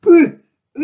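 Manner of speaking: "Ooh" as a high, loud Russian ы grunt; punched-in-the-gut vowel. Shown as a demonstration. Teacher demonstrates the kangaroo-kick vowel, high punched "Ooh". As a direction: jolt out "Ooh" as if kicked in the solar plexus. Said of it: "kangaroo kick in solar plexus".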